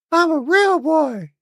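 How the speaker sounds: put-on high, squeaky real-boy voice; mock Pinocchio. Gamer answers the Atlas as a puppet boy, squeaky high. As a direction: pipe up like a wooden puppet boy.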